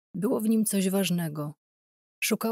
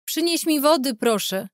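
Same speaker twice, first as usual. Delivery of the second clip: calling out; 8 semitones higher, 5 dB louder than usual. Acted. Mother calls for water.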